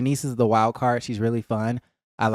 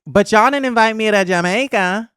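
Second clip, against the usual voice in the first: mocking Jasmine; 8 semitones higher, 4 dB louder than usual. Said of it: "And then Jasmine was like".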